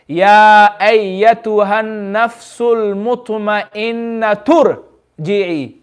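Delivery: drawn-out, slow recitation linking the verses; slow Quran recitation. Shown as a demonstration. Teacher recites the joined verses slowly for the class. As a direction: recite it very slowly, joining the two ayat. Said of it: "listen to how it sounds".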